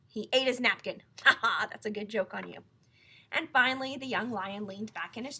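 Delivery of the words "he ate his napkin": plain reading voice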